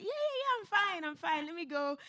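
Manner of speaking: high, loud, falsely cheerful I'm fine; forced brush-off. Retold or imitated. New mum brushing off her husband, high and falsely breezy. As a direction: chirp it out to hide that you're struggling.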